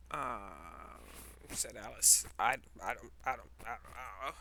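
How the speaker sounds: low, halting stammer; stammering Alice. Acted. Alice stammers back at the Hatter, low and halting. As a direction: trip over every word.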